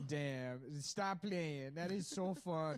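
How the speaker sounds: hammed-up Bronx woman accent. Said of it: "middle-aged women from the Bronx".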